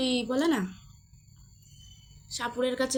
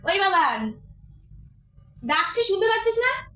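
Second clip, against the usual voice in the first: high-pitched call to another room; 7 semitones higher than usual. Real, not acted. Calling brother in next room.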